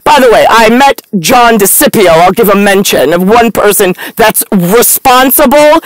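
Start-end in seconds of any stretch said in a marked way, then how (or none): none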